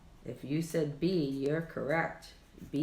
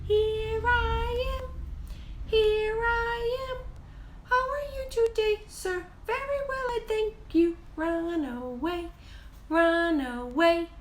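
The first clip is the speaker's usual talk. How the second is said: put-on very high, tiny singing baby voice. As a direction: sing it like the littlest finger. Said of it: "we like to use our baby voice for Pinky".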